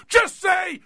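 high, loud yelling imitation of Teller; mock yell right in his face; imitated scream. Teller screaming at his patient to say the line. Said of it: "he's screaming in my face".

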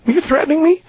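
high, questioning retold voice; really high voice. Questioning a stranger who threatened him, in a really high voice. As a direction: pitch it up high, rising at the end. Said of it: "like, really high voice".

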